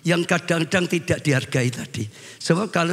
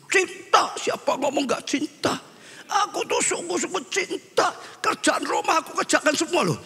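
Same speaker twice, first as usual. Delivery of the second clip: indignant husband; 8 semitones higher than usual. Retold or imitated. Husband protests his love to counselor.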